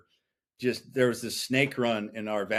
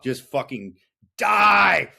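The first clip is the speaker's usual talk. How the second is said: raging yell; 4 semitones higher, 6 dB louder, much slower than usual. Retold or imitated. Reckless skating, raging at life.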